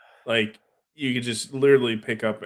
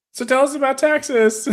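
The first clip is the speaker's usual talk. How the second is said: loud, very high imitation of admirers; mock eager; 7 dB louder than usual. Women turning back to the buddy from Texas, mock eager. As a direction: pitch it way up, imitating eager fans. Women ignore Jersey, fawn over Texan.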